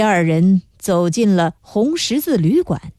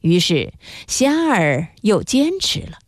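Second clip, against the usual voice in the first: plain reading voice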